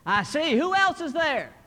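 high, loud phone voice; loud telemarketer. Acted. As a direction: quiz a child loudly down the line. Telemarketer calling a whispering child.

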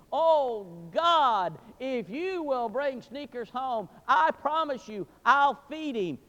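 high, loud, slow wailing prayer; children's desperate prayer. Retold or imitated. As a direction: plead with God like a crying child. Children praying for the dog.

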